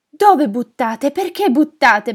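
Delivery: loud, high, slow disbelief; distraught stammering. Acted. Old woman learning her photos were thrown away, distraught. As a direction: cry out the questions, aghast.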